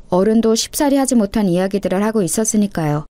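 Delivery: ordinary delivery, nothing marked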